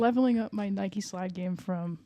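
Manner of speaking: usual speaking voice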